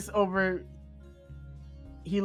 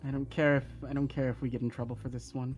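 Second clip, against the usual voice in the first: soft, fast, low resolve; quiet; 4 semitones lower, 8 dB quieter, faster than usual. Acted. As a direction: mutter it with resolve. Ryuji's quiet vow.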